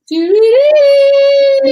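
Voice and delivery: long, high, loud sung doo sound; hammed-up high drawn-out doo-doo-doo; imitated rumble. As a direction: mimic the thunder rolling overhead.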